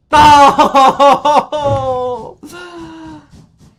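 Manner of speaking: high, loud burst of laughter; cracking up at his own crash; loud cackling laugh. Real, not acted. Laughing at the train he just wrecked, loud high cackle.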